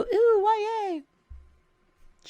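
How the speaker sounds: high, squealy mock gush over YA; mock fangirl squeal. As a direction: imitate a gushing fan, shrill and high. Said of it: "For those who go".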